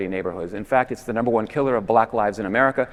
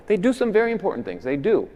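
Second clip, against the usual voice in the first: ordinary talk, nothing marked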